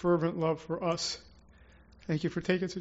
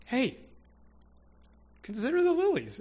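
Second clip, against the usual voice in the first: a higher, gentle voice of the Lord; the Lord speaking; 7 semitones higher than usual. Retold or imitated. The Lord telling the anxious pastor to relax, voiced higher. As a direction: lightly voice the Lord calming a worked-up man. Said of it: "he was like".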